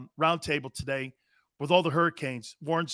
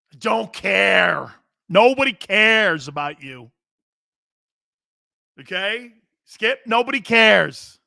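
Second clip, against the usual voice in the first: heated rant; 4 semitones higher, 7 dB louder, slower than usual. Real, not acted. Ranting at Aaron Rodgers.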